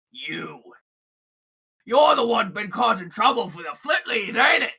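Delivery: loud, accusing thug yell; angry goblin shout. Acted. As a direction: bark the accusation at him. Goblin confronts Gleb angrily.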